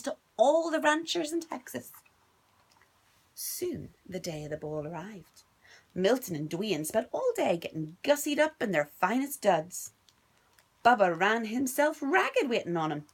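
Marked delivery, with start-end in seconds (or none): none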